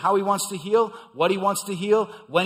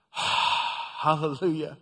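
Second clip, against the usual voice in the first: sighed hallelujah; much slower than usual. Real, not acted. Preacher savoring the healing, slow sighed praise. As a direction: breathe out hallelujah in slow relief.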